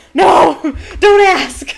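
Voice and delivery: loud, high laughing no; real laughter breaking through a loud high no. Real, not acted. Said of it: "And I put".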